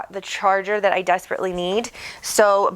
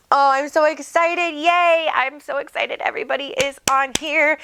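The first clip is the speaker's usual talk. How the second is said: excited; 6 semitones higher than usual. Real, not acted. Host gushing to livestream viewers.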